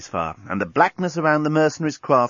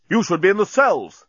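high, snapped rebuke; 8 semitones higher, 4 dB louder than usual. A narrator's reading. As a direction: snap it at them, pitch up. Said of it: "snapped Bolfas".

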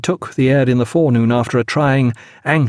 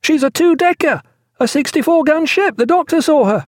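very high, shrieked report; sustained high-pitched excited cry. A narrator's reading. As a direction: shriek the news across the deck. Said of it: "he shrieked to Pullings".

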